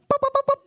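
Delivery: very high, loud spoken key beeps; high popping noises. Acted. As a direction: imitate phone keys with high pops. Mimics girl tapping in pin.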